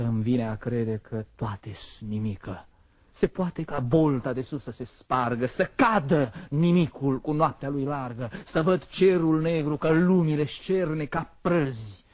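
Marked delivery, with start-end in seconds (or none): 3.1-12.0 building, higher dramatic declamation; apocalyptic outburst. Acted. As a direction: let the vision of collapse swell. Poem imagines the sky collapsing.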